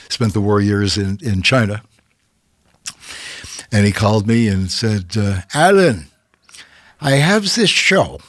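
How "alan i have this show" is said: a hint of a German accent, pitched higher; light German-accent imitation of the Hilton president. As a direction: briefly slip into the hotel boss's German accent, then stop. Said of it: "I won't do his accent".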